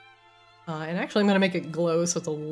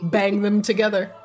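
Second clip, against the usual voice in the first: usual speaking voice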